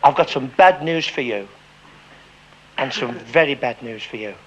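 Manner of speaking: a hint of a sombre doctor; grave doctor. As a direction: deliver bad news heavily. Doctor delivering grave news.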